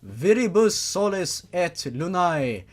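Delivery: slow, solemn chanting of a Latin spell over music; ritual incantation. Acted. Father raising his daughter's soul, solemn incantation. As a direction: intone it like a sorcerer raising the dead.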